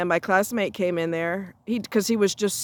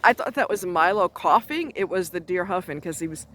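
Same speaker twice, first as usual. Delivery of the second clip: ordinary talk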